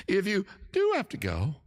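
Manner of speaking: replaying a high-pitched voice slip. Shown as a demonstration. Host repeating the line to viewers after laughing, in a high voice. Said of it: "my voice got really high just then".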